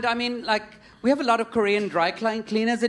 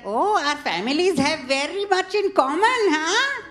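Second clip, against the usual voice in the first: oblivious friendly chatter, stretching "Where is Yom"; hammed-up Indian grandma at a dinner; imitating Indian grandmother; 7 semitones higher than usual. Grandmother's small talk with the mother.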